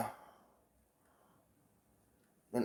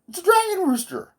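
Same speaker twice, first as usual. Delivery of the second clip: much higher, louder amazed cry; excited outburst; 4 dB louder than usual. Shown as a demonstration. Realising the hallucination is a dragon rooster, excited high cry. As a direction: blurt it out in sudden delight.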